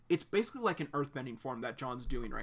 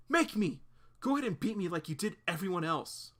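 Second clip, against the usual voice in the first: defiant; 5 semitones higher, 5 dB louder than usual. Acted. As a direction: challenge him, chin up. Claire defying John.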